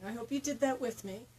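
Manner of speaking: ordinary talk, nothing marked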